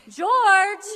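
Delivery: loud call across the house. Acted. Mother calls George to the phone, loud. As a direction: shout his name to fetch him.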